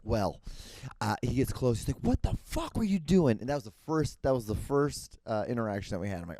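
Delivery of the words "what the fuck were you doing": high, loud outraged demand; angry confrontation. Retold or imitated. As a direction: imitate an irate golfer confronting someone. Retold angry golfer confronting the teller.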